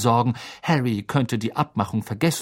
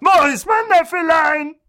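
high, loud gushing call; doting shout; 6 dB louder than usual. Acted. Aunt shouting for her nephew, gushing. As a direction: bellow for the nephew. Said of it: "rührte Tante Magda".

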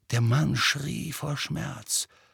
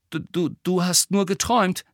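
stammering, higher-pitched reassurance; unsure; 7 semitones higher than usual. A narrator's reading. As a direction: stumble uncertainly over the first word. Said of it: "sagte Ron unsicher". (unsicher stotternd)

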